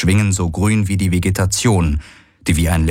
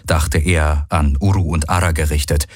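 ordinary delivery

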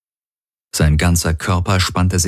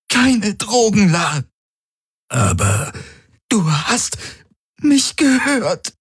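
much higher, pained, slow at the end; strained woman in labour; slower than usual. A narrator's reading. Ylana refusing drugs mid-contraction. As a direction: insist through the pain.

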